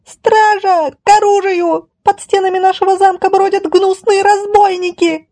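very high-pitched alarm screech; ferocious, sustained high squeal; fierce screeching. Acted. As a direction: shriek for the guards. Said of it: "свирепо заверещала".

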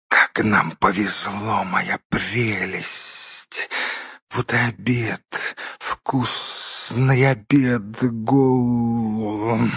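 slow, soft creature whisper with long drawn-out endings; savouring, drawn-out creepy murmur; whispering Gollum. A narrator's reading. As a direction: hiss it out slowly, gurgling on the last word. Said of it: "донёсся шёпот".

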